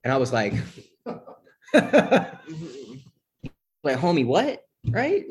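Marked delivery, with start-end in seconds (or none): 1.7-5.2 high, laughing "homie, what"; laughing disbelief. Retold or imitated. Mocking brother's advice, laughing.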